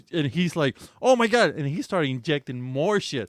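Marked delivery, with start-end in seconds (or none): 1.0-1.5 alarmed doctor. Retold or imitated.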